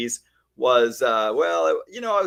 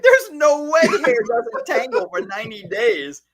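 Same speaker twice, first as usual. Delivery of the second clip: put-on disbelieving young self; 10 semitones higher than usual. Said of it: "I was like".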